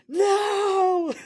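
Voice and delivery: high piercing mock scream of a single word; retold scream. Drunk girl screams from the restaurant, high mock shriek.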